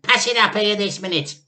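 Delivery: Grandma demanding. A narrator's reading. Grandma demands George hand up the cup. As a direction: order it at once. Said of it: "the enormous old hag shouted".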